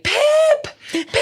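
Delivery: put-on high, loud little kid calling; toddler yelling. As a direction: shout for the cat like a toddler.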